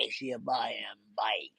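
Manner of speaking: soft unvoiced whisper of the intro. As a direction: breathe it like a secret.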